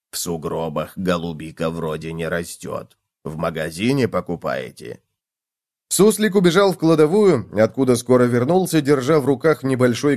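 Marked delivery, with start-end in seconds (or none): 0.1-5.0 low, soft character voice; pondering cockroach. A narrator's reading. Cockroach guesses where food comes from, pondering. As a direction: guess quietly, pitched down.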